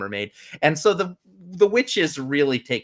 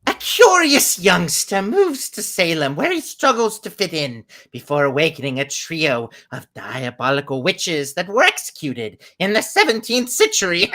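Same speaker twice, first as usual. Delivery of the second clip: witch voice; 7 semitones higher, 8 dB louder than usual. Acted. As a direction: read it like a wicked witch. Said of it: "I'm gonna use witch voice today".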